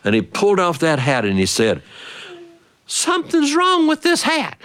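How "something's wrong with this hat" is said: put-on much higher little boy voice. Little brother telling his sister, high child voice. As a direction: pipe up like a small child.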